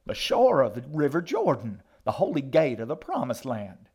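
high, loud holy proclamation; ringing pulpit delivery; fervent old preacher. A narrator's reading. Old woman proclaims holy place.

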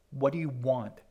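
loud demand through missing front teeth; labored gap-toothed speech. A narrator's reading. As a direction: push the words past a sore mouth. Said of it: "forcing them through the awkward gap in the front of her mouth".

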